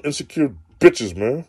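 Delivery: ordinary talk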